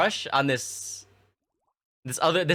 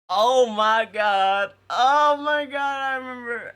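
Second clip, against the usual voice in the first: laughing disbelief; 9 semitones higher, 4 dB louder, slower than usual. Real, not acted. Laughing at the memory.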